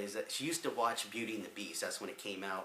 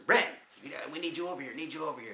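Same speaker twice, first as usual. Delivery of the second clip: calling out; 6 semitones higher, 6 dB louder, faster than usual. Retold or imitated. Friends calling him over.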